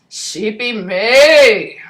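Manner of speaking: slow, lightly done giant voice; giant's voice. Acted. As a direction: speak like a little giant, slowly. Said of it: "a giant's voice 'cause he's so little".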